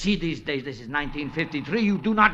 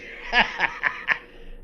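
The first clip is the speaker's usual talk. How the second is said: smug chuckle. Acted. Keatman's smug laugh.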